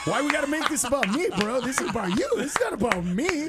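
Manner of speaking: high, indignant comic protest; mock-outraged deflection; defensive protest. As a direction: push back hard at a friend, pitch up. Acted protest to friend in hospital.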